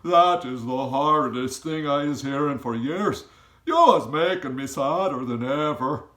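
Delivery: loud giant voice full of sorrow; upset giant. A narrator's reading. Giant tells Sophie her story saddens him, loud. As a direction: pour out the giant's heartbreak loudly.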